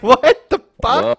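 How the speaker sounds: high, slow stunned outburst; a loud slow "what the"; shocked. Acted. Mock reaction to coworker story.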